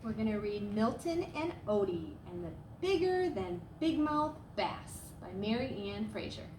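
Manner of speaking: ordinary delivery, nothing marked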